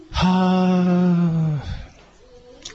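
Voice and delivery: long, even, loose "haa" on the out-breath; relaxed voiced sigh. Shown as a demonstration. Teacher showing the class a loose breathy hum. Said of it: "せーの".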